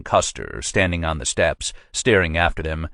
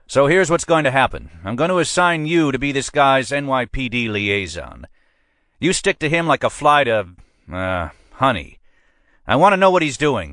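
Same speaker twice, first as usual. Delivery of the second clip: higher-pitched, pushy precinct captain; a captain pressing his man, voiced higher than the narrator; police captain; 8 semitones higher than usual. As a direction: lean on your sergeant like a boss who wants results. Captain assigns liaison.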